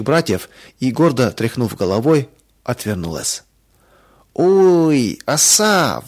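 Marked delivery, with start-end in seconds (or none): 4.3-6.0 high, louder yelp of sudden pain; startled, high-pitched scream; piercing cry. A narrator's reading. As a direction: cry out as if just stung. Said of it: "вдруг пронзительно закричала Оля".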